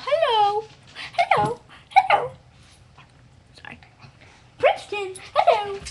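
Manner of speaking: high, loud singsong hello; high puppy greeting. Real, not acted. Girl calling her puppy Princeton in high baby talk.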